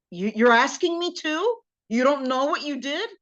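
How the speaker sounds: husband acting affronted at being asked; offended disbelief. Acted offended husband answering his wife.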